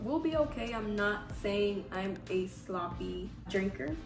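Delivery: ordinary talk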